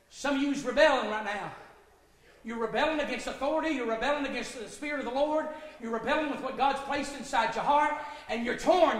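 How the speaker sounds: normal talking voice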